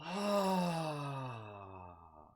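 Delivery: big demo sigh. Shown as a demonstration. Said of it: "nice big sigh".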